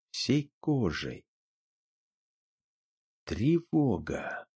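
usual speaking voice

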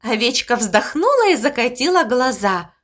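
plain reading voice

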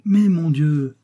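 playfully flustered; comic embarrassment. A narrator's reading. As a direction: protest with amusing embarrassment. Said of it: "reprit-elle avec un embarras plaisant".

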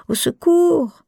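high, loud mock cry; playful cry for help. Woman teasing her lover, squealing a mock cry. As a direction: squeal it while hanging upside down. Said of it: "jusqu'à ce que je crie".

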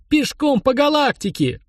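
high, slow, dreamy delight; blissful exclamation. A narrator's reading. Edik relishing a walk across the galaxy, blissfully. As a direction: draw it out high, savouring every word. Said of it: "выдохнул Эдик и блаженно улыбнулся".

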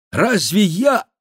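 high, loud broken-off cry; impulsive shout. A narrator's reading. Bussy bursts out to the baron, shouting. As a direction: shout it, then stop short. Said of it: "крикнул Бюсси".

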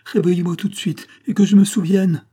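high, loud, urgent command; insistent demand. Acted. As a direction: demand it at once, desperately. Demands to be woken from trance.

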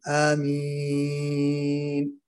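آمين stretched very long and slow; overdrawn amin. Shown as a demonstration. Teacher imitates an exaggerated long آمين. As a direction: drag آمين out slowly. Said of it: "أحيانًا حتى بعضهم".

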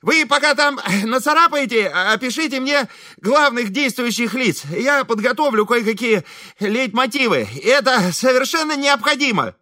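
high-pitched, self-important composer; Ибрагим. A narrator's reading. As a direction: give orders in a high, put-on voice. Реплика композитора Ибрагима. (высокий важный голос композитора)

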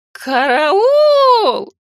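shrill high screech of alarm; screeching gargoyle. Acted. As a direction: screech it out like a panicked stone statue. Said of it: "заверещала".

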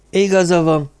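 a show of brisk agreement; forced liveliness. A narrator's reading. Agreeing with Hírek as best he can, forced liveliness. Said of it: "mondta hát a tőle telhető elevenséggel".

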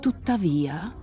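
usual speaking voice

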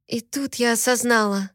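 plain reading voice

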